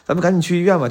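lightly voiced doting TV husband; a hint of soap-opera tenderness; TV drama sweetheart. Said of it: "像电视剧里一样说".

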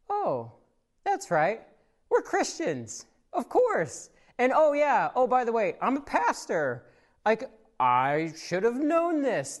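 hammed-up dawning embarrassment, a bit slow at the end; sudden realization. Pastor realizing his own hypocrisy mid-story, mock surprised.

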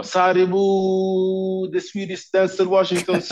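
high, stretched arena announcer call. Retold or imitated. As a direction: call his name out like a ring announcer. Announcer calling out name. (utropare som presenterar honom på arenan)

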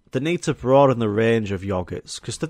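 ordinary talk

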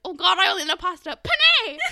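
high, loud mock panic; panicked fake Frenchman. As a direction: act a clueless voice actor blurting out fake French. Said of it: "this guy who's not French".

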